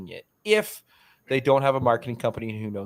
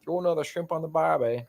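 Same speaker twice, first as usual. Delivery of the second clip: put-on a quick joking stab at an Australian accent, pitched higher; mock Australian accent; 6 semitones higher than usual. Cohost riffs on accents after the Canadian joke, in a mock Australian accent. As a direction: toss off the line as a cheesy Australian cliché. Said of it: "It's obviously not the Canadian accent".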